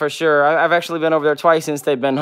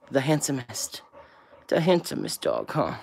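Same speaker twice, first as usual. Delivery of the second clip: whisper; 3 semitones lower, 12 dB quieter than usual. Real, not acted. Cooing at her dog.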